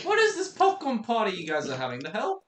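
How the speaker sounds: fast, high disbelief; baffled outburst; surprised. Real, not acted. Surprised at popcorn party.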